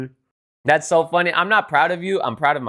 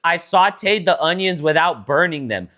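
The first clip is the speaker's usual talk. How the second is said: mocking sister; 7 semitones higher, 3 dB louder than usual. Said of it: "She's about to be like".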